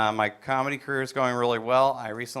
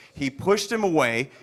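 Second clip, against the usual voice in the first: ordinary delivery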